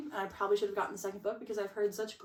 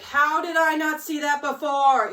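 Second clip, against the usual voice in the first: slower, louder, higher disbelief; shocked realisation; 5 semitones higher, 8 dB louder, slower than usual. Retold or imitated. Reader hit by the plot twist, stunned disbelief. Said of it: "you're like".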